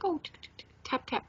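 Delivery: soft and high, dainty little taps; mock gentle tapping. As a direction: tiptoe the words like tiny gentle taps. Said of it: "at first I was gentle".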